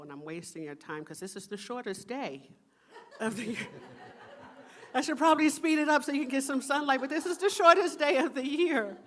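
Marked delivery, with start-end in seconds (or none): 4.9-9.0 much higher and louder, fast, through laughter; joking with the congregation about the short day, laughing. Real, not acted. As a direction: laugh at your own slowness. Laughing joke about talking slow.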